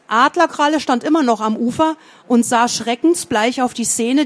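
ordinary delivery

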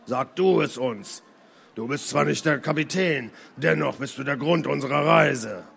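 langsam, mit fremder Stimme; ruhiger, rauer Seemann. Acted. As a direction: dem Anführer die Last zurückgeben. Seemann antwortet Kyrian. (bedächtiger alter Seebär)

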